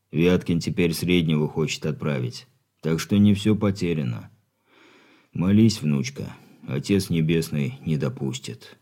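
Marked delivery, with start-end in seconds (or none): 5.3-8.8 lower, softer grandfather voice; grave comforting old man. Acted.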